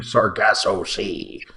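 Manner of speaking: slow, lightly growled pirate voice. Pretend. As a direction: say it like an old pirate. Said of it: "your brilliant pirate voice".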